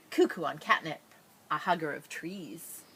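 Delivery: plain reading voice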